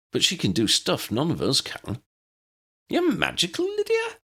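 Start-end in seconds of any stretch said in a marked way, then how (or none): 2.9-4.2 high, squeaking with surprise; small wizard astonished and pleased; astonished and delighted. Acted. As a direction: gasp it out, thrilled. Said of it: "Flitwick was astonished and delighted".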